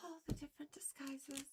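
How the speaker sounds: breathy whispered repeat of "All the different disguises"; a soft conspiratorial whisper. Shown as a demonstration. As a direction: hush it like a secret.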